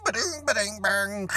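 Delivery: put-on high, sing-song ba-ding beeps like an old dial-up modem; old modem noise. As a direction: imitate a computer chiming like robots fighting. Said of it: "it makes that sound like ba-ding".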